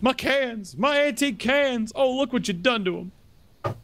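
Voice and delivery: high, slow hillbilly lament; mock country wail. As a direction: wail like a heartbroken country man. Mimicking upset game character.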